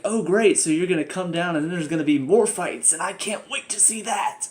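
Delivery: loud, high mock delight; sarcastic. Reviewer mocking the coming fights, sarcastic delight. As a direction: drip with fake enthusiasm. Sarcastic reaction to daughter's request.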